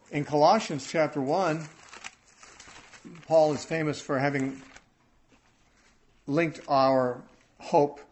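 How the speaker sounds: building pulpit proclamation; preaching. Acted. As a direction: preach the hope out to the hall. Preaching with rising force.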